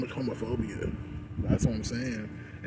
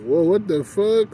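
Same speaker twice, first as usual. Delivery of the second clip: loud, high, drawn-out retold reaction; indignant disbelief; 8 semitones higher, 10 dB louder, slower than usual. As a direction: act out being taken aback, slow and loud. His indignant reaction to the gawkers.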